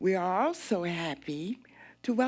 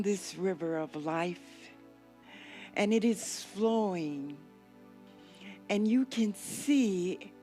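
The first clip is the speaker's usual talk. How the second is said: guided meditation; 5 dB quieter than usual. Acted. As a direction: lull them gently down the river.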